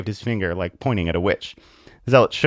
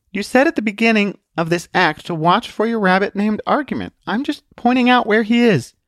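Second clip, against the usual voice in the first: high, eager, innocent protest; child's high-pitched explanation; insistent little girl; 12 semitones higher, 4 dB louder than usual. Acted. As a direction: point out the facts to the magician. Truth explains herself.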